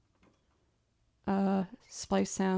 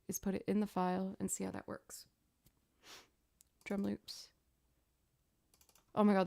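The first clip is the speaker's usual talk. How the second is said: ordinary talk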